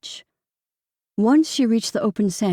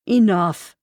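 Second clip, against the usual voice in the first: louder firm cut-off; sharp interruption; 6 dB louder than usual. A narrator's reading. Son cuts off his sister's blaming, firm and louder. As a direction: stop the argument dead. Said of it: "Brody interrupted her".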